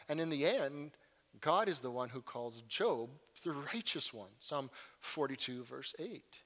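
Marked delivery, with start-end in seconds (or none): none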